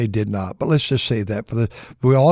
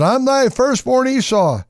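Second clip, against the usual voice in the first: eager son; 11 semitones higher than usual. Acted. Esau arrives.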